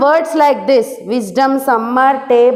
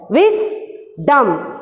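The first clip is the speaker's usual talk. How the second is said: "Wisdom" in two beats, a clear pause after Wis; split into syllables. Shown as a demonstration. Teacher showing the class two syllables, "Wisdom" split Wis-dom. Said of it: "So these were the two syllables".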